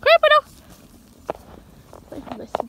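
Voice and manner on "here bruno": very high coaxing call; high, louder call to her dog. Real, not acted. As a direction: coax the dog over in a high voice.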